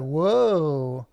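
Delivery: long whoa arcing up and down; slow rise-and-fall swoop; playful whoa. Pretend. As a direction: stretch the whoa like a swooping motion. Voicing the rotation.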